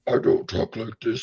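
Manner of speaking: lightly dropped into his villain voice; a hint of the Destro voice. Shown as a demonstration. Voice actor showing viewers his deep villain voice. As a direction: show the character voice briefly. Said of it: "my voice isn't that deep".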